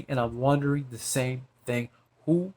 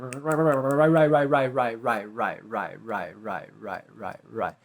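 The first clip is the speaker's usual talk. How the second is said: repeated word steadily sinking in pitch; fading repetition; 4 semitones lower, slower than usual. Acted. Speaker spirals on after mimicking the other man, fading repetition.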